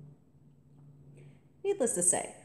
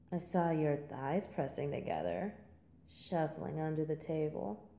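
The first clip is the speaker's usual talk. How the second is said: normal talking voice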